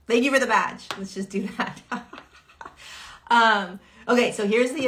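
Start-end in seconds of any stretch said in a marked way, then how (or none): 0.1-0.9 bright, loud thank-you to a viewer. Real, not acted. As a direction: call out thanks warmly to the chat.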